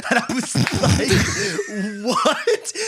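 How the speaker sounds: high, slow words through laughter; laughing disbelief. Real, not acted. Telling friends the grandpa story, laughing through it.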